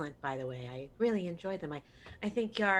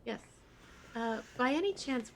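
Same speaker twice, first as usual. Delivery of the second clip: ordinary talk, nothing marked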